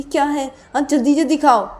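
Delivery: high, loud impatient command; acted angry order. Character orders partner to eat quickly, angrily. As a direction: bark it out to hurry them up. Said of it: "गुस्सा क्यों हो रही हो?".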